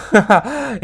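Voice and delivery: loud, high delighted laugh; burst of laughter. Real, not acted. Gamer laughing at his own win, joyful.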